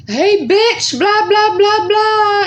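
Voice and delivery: high, loud call-out; yelling at bullies. Retold or imitated. Best friend yelling at the bullies from behind, high and loud. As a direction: shout after them to stand up for her.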